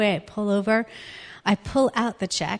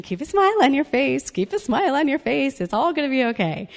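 forced cheerful self-coaching; 6 semitones higher than usual. Retold or imitated. Talking herself into staying composed.

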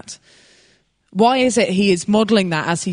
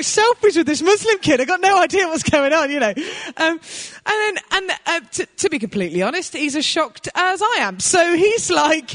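ordinary talk

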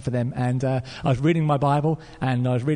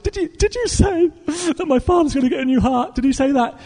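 high, tearful, halting voice; sobbing; 12 semitones higher than usual. Retold or imitated. Crying woman asks pastor about the new heart, high sobbing. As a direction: imitate a weeping woman's hopeful question. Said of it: "she was still sobbing and crying".

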